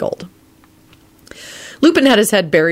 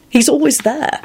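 pitched higher, voice cracking; higher, grieving repeat; repeated, higher and mournful; 10 semitones higher than usual. A narrator's reading. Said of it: "he repeated".

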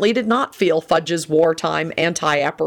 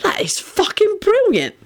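admiring. A narrator's reading. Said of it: "Sirius said admiringly".

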